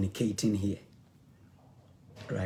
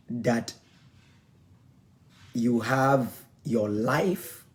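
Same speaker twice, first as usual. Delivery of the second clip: rising, louder preacher's build; preaching; 3 dB louder than usual. Acted. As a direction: drive the point home like a pastor.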